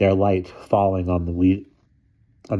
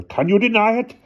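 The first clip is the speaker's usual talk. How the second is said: high, tipsy hiccuped challenge; hiccuping; 4 dB louder than usual. A narrator's reading. Drunk guard pointing at Hannah, high hiccuping voice. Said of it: "he hiccuped".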